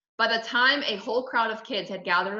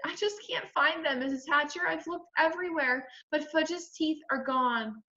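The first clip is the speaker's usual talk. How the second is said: put-on high, tearful girl's voice; sniffling; 8 semitones higher, 4 dB quieter than usual. A narrator's reading. Sheila confessing to Missus Hatcher, sniffly and upset. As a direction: report it tearfully, pitched up without volume. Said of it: "Sheila sniffled and said".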